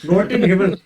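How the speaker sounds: put-on loud German accent with an Indian twist. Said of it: "You said German, but with an Indian accent".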